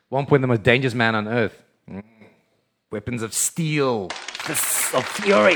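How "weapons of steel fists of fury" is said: slow dramatic trailer voice, pitch up on fury; hammed-up movie-trailer boom; drawn-out mock epic announcement; mock announcer. Announcer-style hype delivery.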